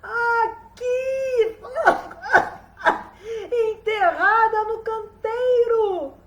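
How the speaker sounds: high, loud creature cry; high drawn-out call with a cackle; magic ear calling from underground. A narrator's reading. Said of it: "lá de dentro do buraco, lá do fundo".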